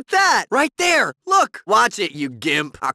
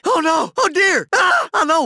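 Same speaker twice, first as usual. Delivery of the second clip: panicked cry; 11 semitones higher, 4 dB louder than usual. Acted. Bystander panics at sudden danger.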